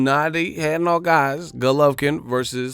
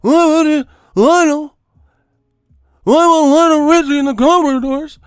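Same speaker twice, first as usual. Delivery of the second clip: mock pundit listing; 10 semitones higher, 8 dB louder than usual. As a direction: list the names like a clueless pundit.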